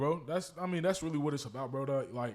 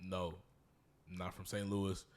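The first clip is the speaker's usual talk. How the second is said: lower, softer, slow insistence; deadpan correction; 9 semitones lower, 11 dB quieter, slower than usual. Real, not acted. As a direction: correct them calmly and slowly. Patient correction.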